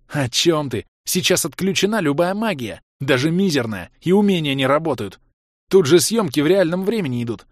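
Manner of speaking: bright eager gamer girl, lightly voiced; lively young woman. A narrator's reading. Chloe voiced as a character.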